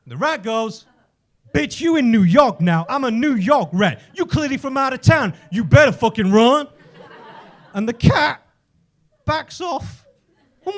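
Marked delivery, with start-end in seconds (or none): 1.5-6.7 loud, cocky street threat; confrontational New York rat. Acted. New York rat to the out-of-town cat, loud swagger. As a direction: front up loudly, talking down to them.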